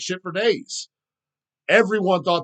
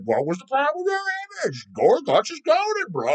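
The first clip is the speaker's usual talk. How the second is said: much higher, put-on whiny poser voice; mocking young poser; 12 semitones higher than usual. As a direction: ape a clueless nineteen-year-old fan. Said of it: "I was like".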